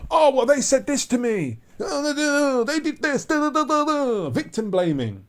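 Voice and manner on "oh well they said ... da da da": higher, whining excuses of a caught killer; whiny murderer excuse. Retold or imitated. Vlogger mimics a caught murderer making whiny excuses. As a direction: play the caught killer pleading innocence. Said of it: "It's like a murderer who gets caught".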